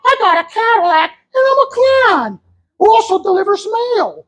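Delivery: very high clown voice; clown suitor. Pretend. Clown wooing his future wife, very high squeal. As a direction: squeal it proudly in falsetto. Plays the clown answering her wish.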